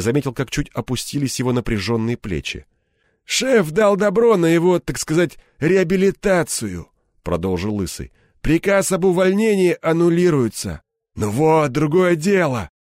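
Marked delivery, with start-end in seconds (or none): 3.2-10.8 higher-pitched announcing voice; bald gang boss, official. A narrator's reading. Реплики Лысого. 11.1-12.7 higher, self-satisfied remark; smirking approval. A narrator's reading. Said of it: "ухмыльнулся Кирпич".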